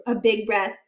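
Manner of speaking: ordinary delivery, nothing marked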